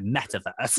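mock-sassy imitation of her tone. Host mimics guest's way of saying metaverse, sassy. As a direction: spit it out like a swear word, teasingly.

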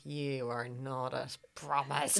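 slow, low mock disapproval; low, slow imitation of hostile onlookers; disapproving audience member. Voicing disapproving Irish boys in audience.

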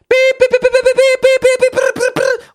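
very high, loud rapid beeps; shrill insistent doorbell imitation; imitated doorbell beeping. Podcaster mimics garbage men storm-ringing his bell, frantic high beeping. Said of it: "die klingeln dann halt so".

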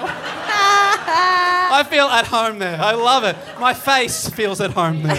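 loud, laughing, near-crying gush; overjoyed, laughing it out; laughing rapture, loud and stretched out. Real, not acted. Gushing about loving England.

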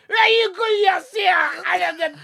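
very high Scottish drinker caricature; thick Scottish accent. Retold or imitated. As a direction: offer a drink in squeaky, mangled Scots. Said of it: "had the same accent as the fella".

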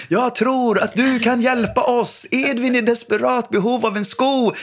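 high, theatrical game-master voice; playful game voice. Retold or imitated. Dad calling his son into a pretend mission, high theatrical voice. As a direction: sell it like a grand adventure to the kids.

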